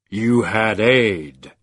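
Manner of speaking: slow, loud, measured menace; deep voice. A narrator's reading. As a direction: drawl it like an abyss. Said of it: "the deep voice said".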